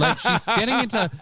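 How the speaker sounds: can't stop laughing. Real, not acted. Co-host laughs while describing the anchor's impression.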